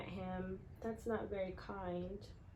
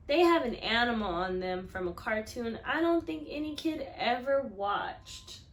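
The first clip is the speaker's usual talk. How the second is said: expressive story reading; 2 semitones higher, 9 dB louder than usual. A narrator's reading. Teacher reading the kickball scene to her class, lively storytelling. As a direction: read it big for the class.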